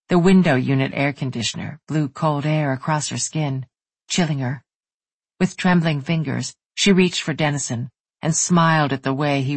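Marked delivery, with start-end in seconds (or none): none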